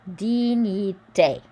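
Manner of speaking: careful slow French Dignité; French pronunciation. Shown as a demonstration. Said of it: "the G N is a N sound".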